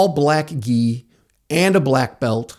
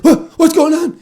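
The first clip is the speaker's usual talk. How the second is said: much higher, louder fake panic like bad movie acting; hammed-up panic; 14 semitones higher, 4 dB louder than usual. Man coming to after a choke, hammy high panic. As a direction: overact a panicked wake-up. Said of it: "like bad acting in a bad movie".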